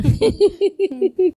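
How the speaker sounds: a short relieved laugh rising high. Real, not acted. Mother laughing at last about her baby, relieved giggle. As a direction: let out a bright relieved giggle.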